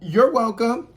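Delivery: high, loud, exaggerated sing-out; passive aggressive. Shown as a demonstration. Customer correcting a thankless drive-through worker, loudly passive aggressive. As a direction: throw it out high and loud as a jab. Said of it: "it feels passive aggressive".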